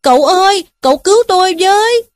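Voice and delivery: high, loud, fast desperate plea; crying out for help. Acted. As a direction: shout for rescue in panic. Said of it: "kêu la lên". (kêu cứu hoảng hốt, cao và to)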